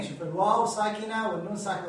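normal talking voice

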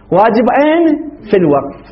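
ordinary delivery

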